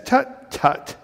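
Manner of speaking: high, dismissive bird's tut; Maisie tutting. A narrator's reading. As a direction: brush off his worry lightly. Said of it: "answered Maisie".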